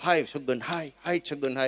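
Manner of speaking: put-on keen it like old village mothers; mock wailing lament. Comedian repeats the mourning women's lament.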